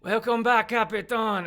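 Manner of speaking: high, loud, flamboyant captive; Duke. Acted. Duke accepting the offer to team up, loud high welcome. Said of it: "The Duke looks at you and is like".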